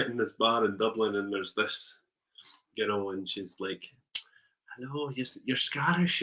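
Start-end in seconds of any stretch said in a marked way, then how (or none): 4.8-6.2 bad Irish accent. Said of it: "really crap Irish accent".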